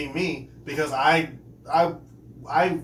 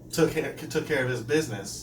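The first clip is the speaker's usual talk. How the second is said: usual speaking voice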